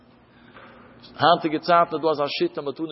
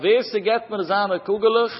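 raised, higher-pitched call; 6 semitones higher than usual. Retold or imitated. Boy to his friends over lost marbles, calling out. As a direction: call out the offer to a group. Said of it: "er rief aus zu seinen Chawairen".

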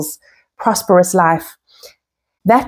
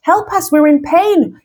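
high pleading cry to God; 8 semitones higher than usual. Retold or imitated. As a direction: beg for help, voice lifted in pain. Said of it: "We're crying out to Hashem".

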